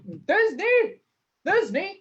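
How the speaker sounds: gruff voice calling out; monstrous little voice. Shown as a demonstration. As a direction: call out gruffly to Thisbe. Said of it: "monstrous little voice".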